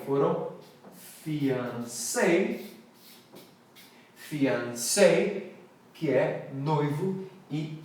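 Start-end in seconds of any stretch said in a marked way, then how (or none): 1.2-5.4 fiancé said fee-ahn-SAY, stress at end; French fiancé. Shown as a demonstration. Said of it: "palavras de origem francesa". (fiancé com tônica no final, fiansê)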